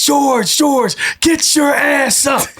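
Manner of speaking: high, loud drill-coach yell; screaming coach. Retold or imitated. Coach yelling at a player lying on the field, screaming. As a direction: shout orders at full pitch, imitating an irate coach. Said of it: "my coach was screaming".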